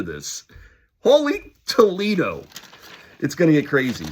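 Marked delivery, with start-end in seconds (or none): 1.0-2.4 high, loud, stretched-out exclamation; slow, much higher, loud holy Toledo; astonished exclaim. Real, not acted. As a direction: blurt it out, amazed. Shocked it's only three months.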